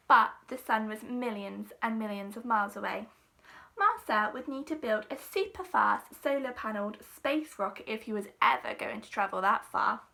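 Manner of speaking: ordinary delivery, nothing marked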